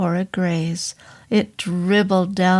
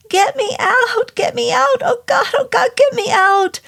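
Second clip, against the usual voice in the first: gibbering terror; 4 dB louder than usual. Acted. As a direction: beg for rescue in sheer agony. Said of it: "he gibbered".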